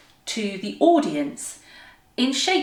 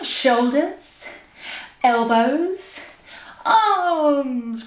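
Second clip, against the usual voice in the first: stretched words, raised and energetic; big drawn-out call; 5 semitones higher, much slower than usual. Shown as a demonstration. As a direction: stretch each word with a big final push. Last, bigger round of exercise.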